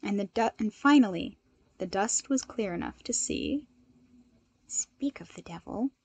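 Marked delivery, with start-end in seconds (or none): 4.6-5.9 soft whispered aside; murmured. A narrator's reading. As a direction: breathe it under your breath to a friend. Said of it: "Midoriya murmured".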